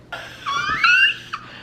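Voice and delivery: very high-pitched squeal of a laugh; shriek-laugh like a giddy crush; high giddy cackle. Retold or imitated. Squeals mid-story over her crush.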